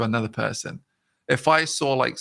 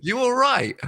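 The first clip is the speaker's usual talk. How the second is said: much higher friendly you all right; high sing-song; 13 semitones higher, 4 dB louder, slower than usual. Shown as a demonstration. Showing how the way you say it matters, high sing-song greeting. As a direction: lilt it up brightly. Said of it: "the way you say it".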